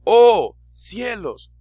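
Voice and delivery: oh muy alto y fuerte, cielos alto; asómbrate de verdad al decir oh cielos; exclamación de sorpresa. Shown as a demonstration. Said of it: "va a depender de la inflexión que le demos a la voz". (sorpresa exagerada de profesor)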